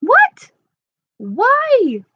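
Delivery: sudden high, loud "What?"; surprised. Real, not acted. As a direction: jump up in pitch, startled. Mock surprise at off-screen question.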